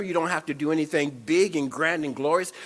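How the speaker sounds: ordinary delivery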